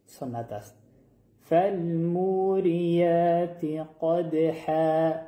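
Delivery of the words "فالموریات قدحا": slow chanted tajwid recitation, drawn out; Quranic recitation. Shown as a demonstration. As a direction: recite it slowly with full tajwid. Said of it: "تکرار کنید آیه را با من".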